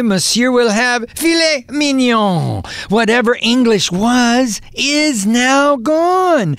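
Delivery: rhythmic, performed poetry reading; poem recital. Acted. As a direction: recite it like a stage poet. Reads his French poem aloud.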